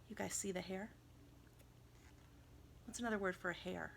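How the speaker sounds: usual speaking voice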